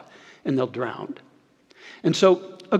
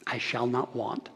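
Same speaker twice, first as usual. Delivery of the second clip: hushed proclamation; 3 semitones lower, 9 dB quieter than usual. Acted. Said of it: "then he would proclaim".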